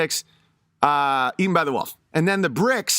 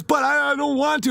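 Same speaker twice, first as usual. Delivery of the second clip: scared pleading pig; 9 semitones higher than usual. Retold or imitated. Frightened stick pig to the knife pig, high pleading. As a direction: plead in a pitched-up mock whimper. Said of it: "And the pig says".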